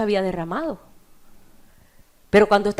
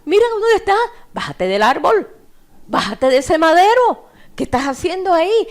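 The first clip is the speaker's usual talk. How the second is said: raised, high-pitched heckling; jeering shouts; 6 semitones higher, 4 dB louder than usual. Acted. Crowd insulting Jesus on the cross, jeering shouts. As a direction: heckle him like a hostile crowd. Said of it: "y le gritaban".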